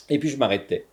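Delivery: ordinary delivery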